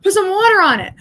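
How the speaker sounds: high, urgent mock plea; retold panic. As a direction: imitate a flustered partner giving orders. Acting out partner's panic.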